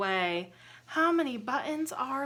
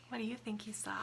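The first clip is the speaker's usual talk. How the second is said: teasing whisper; 2 semitones lower, 12 dB quieter, much faster than usual. Acted. Teasing question to children.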